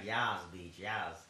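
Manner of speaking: ordinary talk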